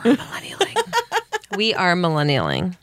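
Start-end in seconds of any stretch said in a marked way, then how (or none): 0.0-1.5 giggly whispered line; laughing whisper. Real, not acted.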